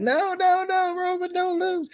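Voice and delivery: high mock-panicked plea at the wrestler; anxious fan pleading. Fan begging Roman not to lose, high mock-panicked cheer. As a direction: wail it high, stretching each "no". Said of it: "really had me feeling like".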